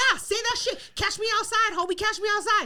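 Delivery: high, combative taunt; imitated challenge. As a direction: dare him to step outside. Daughter challenging drunk man.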